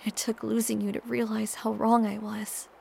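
ordinary delivery, nothing marked

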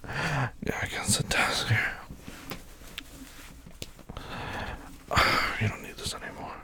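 very soft, breathy directions; hushed and in control; whispered instructions. Acted. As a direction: murmur it privately to a partner. Intimate directions to partner.

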